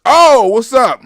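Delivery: loud, high hello; light up at a new name in chat; excited greeting. Real, not acted. Greeting a viewer who joined the stream, loud and high.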